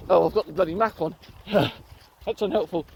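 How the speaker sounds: ordinary talk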